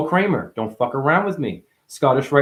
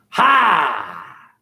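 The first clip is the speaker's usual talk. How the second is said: long, falling exclamation; loud "ha" cry; 5 semitones higher, 4 dB louder than usual. Real, not acted. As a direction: burst out with a drawn-out ha. Punctuates wordplay with a cry.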